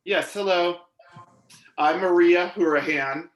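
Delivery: normal talking voice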